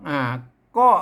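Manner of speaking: normal talking voice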